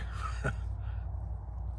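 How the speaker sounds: short breathy chuckle; brief laugh at own wordplay. Real, not acted. Chuckles at his bark joke.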